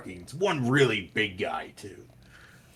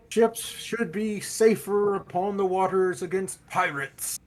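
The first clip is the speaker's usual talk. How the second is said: town crier yelling; 11 semitones higher, 6 dB louder than usual. Acted. Town crier announcing island news, yelled out. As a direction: call out the news loud and high, stretching the words. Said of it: "they yell out".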